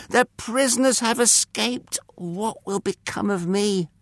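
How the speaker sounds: high-pitched, despairing outcry; panicked cry. A narrator's reading. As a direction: cry out in dread of the consequences. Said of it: "he cried".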